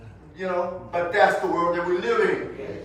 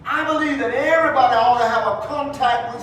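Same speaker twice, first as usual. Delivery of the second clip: loud, higher preacher's build; preaching at full force; 6 semitones higher, 6 dB louder than usual. Acted. Preacher pressing the congregation toward Jesus, full-force preaching. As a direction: raise it to a fervent preaching peak.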